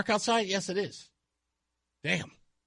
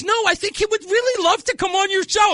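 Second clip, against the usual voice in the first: eager wife; 12 semitones higher, 4 dB louder than usual. Retold or imitated. Wife's eager suggestion acted out.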